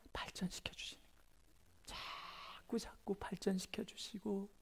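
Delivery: soft, slow, unvoiced whisper; intimate whisper. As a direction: draw listeners close with a whisper. (조용히 속삭이는 설교)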